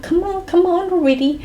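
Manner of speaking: high-pitched imitation of impatient mom. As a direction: hurry them along, mock-impatient and high. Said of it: "And Mummy's impatient".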